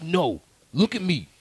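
a hint of a pushy older mentor; older gentleman. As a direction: press it on him like a stern mentor. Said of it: "And he's like".

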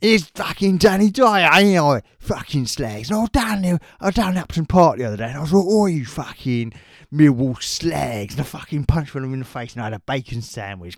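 loud, brash Cockney geezer swearing; Danny Dyer impression. Host doing a loud Cockney Danny Dyer rant to co-host. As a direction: shout it like a hard East End Cockney. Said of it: "you've done Danny Dyer swearing".

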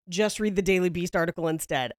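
usual speaking voice

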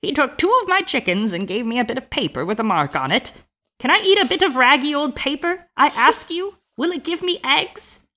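an outraged peasant wife building to a squeak; indignant old woman. A narrator's reading. Old woman scoffing at the paper payment, high indignant rant.

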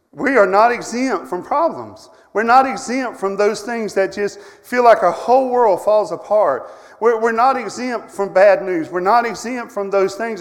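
loud, hammering sermon voice, punch every NOT; a preacher pounding out a refrain; preaching at full force. Acted. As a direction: preach it hard to the congregation. Preacher driving the point home.

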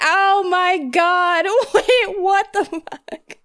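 high, slow words broken by laughter; laughing disbelief. Retold or imitated. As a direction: laugh through the shock, drawn out. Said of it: "I was like".

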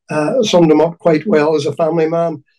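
ordinary delivery

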